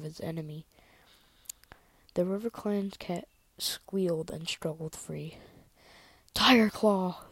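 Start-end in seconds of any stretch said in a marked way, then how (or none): 6.3-7.3 loud, raised warrior's yell; higher, much louder shout of a name. Acted. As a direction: call out urgently across the battle. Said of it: "The yell came from a warrior".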